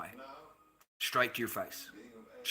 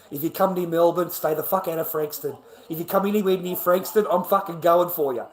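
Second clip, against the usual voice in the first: raised, higher voice, warning them off; retold threat; 5 semitones higher, 7 dB louder than usual. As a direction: retell a loud threat. Retelling threats he made.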